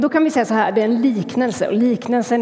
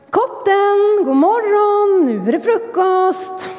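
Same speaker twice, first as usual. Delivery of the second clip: high, bright call up the stairs; mother calling; 10 semitones higher, 3 dB louder, slower than usual. Acted. Mother calls hedgehog to breakfast, high calling voice. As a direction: call the sleepy son down to breakfast.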